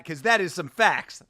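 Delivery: usual speaking voice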